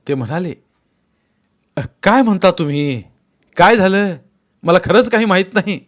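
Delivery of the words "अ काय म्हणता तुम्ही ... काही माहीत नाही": high, loud startled denial; plead, I truly know nothing; bewildered protest. Acted. Bhagwanrao denying to the angry Patil, alarmed protest. Accused man protests innocence.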